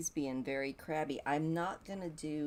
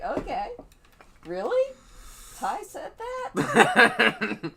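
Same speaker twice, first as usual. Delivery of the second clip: high, slow, laughing disbelief; mock surprise; 8 semitones higher, 10 dB louder, slower than usual. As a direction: feign shock through laughter. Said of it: "I would just have to be like".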